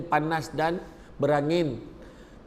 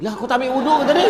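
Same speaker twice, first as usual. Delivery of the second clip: loud, fast, high-pitched disbelief; hammed-up incredulous question; acted shocked outburst; 8 semitones higher, 6 dB louder, much faster than usual. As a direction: blurt it out as an appalled imam. Imam shocked the boy skipped ablution.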